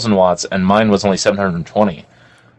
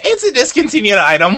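high-pitched put-on clerk voice; mock the clerk in a raised pitch; imitated dismissive employee, pitched up; mimicked store clerk; 12 semitones higher than usual. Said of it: "she's like".

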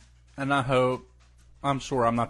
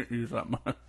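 munching popcorn; 5 dB quieter than usual. Real, not acted. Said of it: "sorry, I'm eating".

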